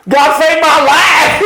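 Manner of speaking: high, slow, loud outburst; blurted shout. Retold or imitated. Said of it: "I went".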